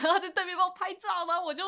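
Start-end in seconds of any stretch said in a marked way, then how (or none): none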